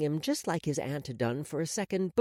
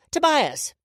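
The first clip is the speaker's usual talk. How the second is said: high, loud summons; calling out; 11 semitones higher, 6 dB louder than usual. A narrator's reading. Woman summons her helper nearby, calling out. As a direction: call to someone close by. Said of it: "I called out".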